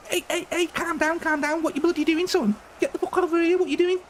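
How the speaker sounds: high-pitched Scouse mock rant; Liverpool accent. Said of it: "That's, that's Liverpool".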